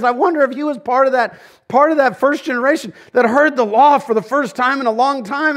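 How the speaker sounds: high, loud preacher's cry; fiery preaching. Acted. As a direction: ride the wave of the sermon. Preaching at full force.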